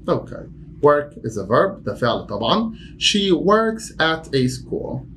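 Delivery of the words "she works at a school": slow, with "works" lifted; slow model sentence. Shown as a demonstration. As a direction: model it slowly for learners. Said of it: "teacher demo sentence".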